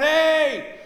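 yelling through a horn. Retold or imitated. Coast Guard pilot shouting to man below, loud. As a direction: shout down over the rotor noise. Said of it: "he starts yelling in his horn".

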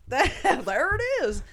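slow, high, laughing; laughing "there it is". Retold or imitated. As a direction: savour it with a laugh. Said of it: "and I was like".

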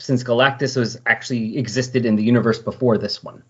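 normal talking voice

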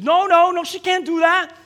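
high, loud protesting nurse; nurse refusing. Retold or imitated. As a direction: refuse him sharply.

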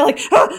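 acted gasp between gulps; gasping while chugging. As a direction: gasp as if gulping down a potion. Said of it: "trying to chug it".

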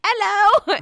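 loud, high-pitched Cockney greeting; Cockney accent. Acted. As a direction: belt it out in a bad Cockney accent. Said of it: "like, a Cockney accent".

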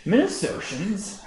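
Minnesotans tried slow with a rising twang; a fumbled Minnesota accent on Minnesotans; attempted Minnesota accent. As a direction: outsider copying a Minnesota accent. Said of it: "I was trying to do your accent".

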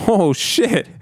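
high-pitched stunned exclamation; retold shock. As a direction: let it leap up in astonishment. Retelling shock at priest's story.

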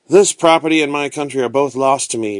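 plain reading voice

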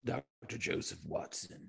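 squeaky clicking bat; soft, odd bat voice. Acted. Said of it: "Oh, bat voice".

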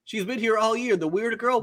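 high, exasperated imitated insistence; worked-up high retelling; mock freak-out. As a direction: play-act frantic explaining. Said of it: "he's freaking out".

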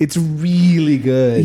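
slow, stretched out really; insisting the movie is good, slowly; emphatic. Real, not acted. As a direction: lean into really and draw it out. Emphatic repeated praise.

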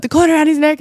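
high, loud, fast and urgent; blurting the news in a rush; excited husband. Retold or imitated. Imitating her husband telling her about the cord, high and loud. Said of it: "but he's like".

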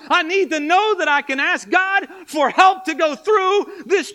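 high, loud rallying preacher cadence; shouted revival-style exhortation; full-force preaching. Real, not acted. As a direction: fire up the congregation with pounding repeated what-ifs.

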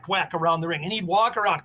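normal talking voice

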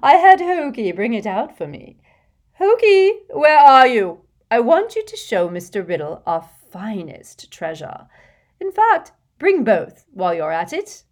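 put-on fussy, gushing rich old lady, laughing in; a vain old witch showing off to a charming boy; giggly hostess voice. Hepzibah showing off treasures.

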